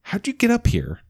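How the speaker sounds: high startled question; surprised squeak. Acted. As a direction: caught off guard by a small dog. Surprised at Bjorn's arrival.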